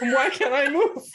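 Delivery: high, loud hammed-up plea; mock bewilderment. Host echoes co-host's impression of the stuck hero, loud mock bewilderment. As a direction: echo the bad acting in a high voice.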